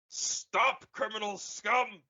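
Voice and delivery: high, hammed-up guard yell; a hint of a stern guard; mock guard shout. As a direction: bark it like a game guard. [name] yells line.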